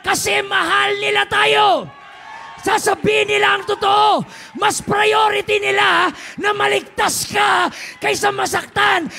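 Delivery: loud, high, drawn-out preaching shout; full-force preaching. Acted. Preacher shouting to the congregation about truth, full force. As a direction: thunder it from the pulpit.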